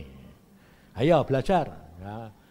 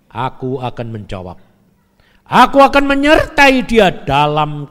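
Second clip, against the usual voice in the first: preaching at full force; 4 semitones lower, 14 dB louder than usual. Acted. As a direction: proclaim God's promise to the whole hall, slowly. Reads promise with full force.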